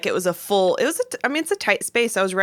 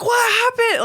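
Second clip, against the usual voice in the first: high-pitched call from another room; imitated yell; 3 dB louder than usual. Husband calling to wife from the living room, imitated yell. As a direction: mimic a husband yelling over. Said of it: "I can hear him yelling".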